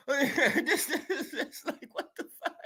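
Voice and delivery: breaking into laughter mid-word; laughing. Real, not acted. Vlogger laughing in disbelief at his critics.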